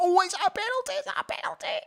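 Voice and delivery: high-pitched, breathless cry of excitement; excited penalty shout. Real, not acted. Fan watching the match yelling it's a penalty, excited. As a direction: jump up, sure it's a penalty.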